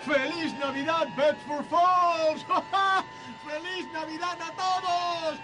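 running through town yelling Merry Christmas; ecstatic shouting. Acted. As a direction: shout your joy down the street. George yelling greetings through town.

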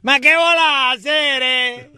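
put-on high, slow Italian accent; loud, hammy Italian accent. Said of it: "hablando con acento italiano".

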